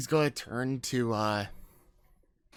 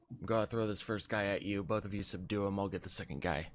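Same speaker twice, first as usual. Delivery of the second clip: hushed orders; 5 semitones lower, 7 dB quieter than usual. Acted. Quick tactical order to teammate.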